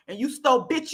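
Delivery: ordinary talk, nothing marked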